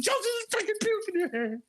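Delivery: very high, fast mock panic; hammed-up exasperated insistence, voice shooting up; frantic insistence. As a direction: retell it squealing and rushing. Retold frantic argument over puke.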